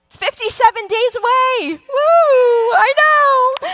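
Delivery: high, loud, whooping excitement; real squealing, shouted delight; excited cheer. Real, not acted. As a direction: whip the crowd up about Christmas. Cheering the countdown to Christmas.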